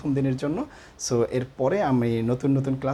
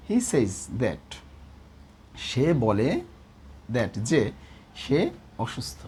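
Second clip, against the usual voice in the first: slow dictation; 2 semitones lower, much slower than usual. Shown as a demonstration. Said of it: "দুই নাম্বারে বলছে".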